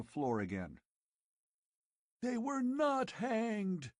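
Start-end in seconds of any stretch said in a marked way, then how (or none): 2.2-3.9 slow, shrill high screech. Acted. Old chief screeching at Conan, enraged. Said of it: "he screeched".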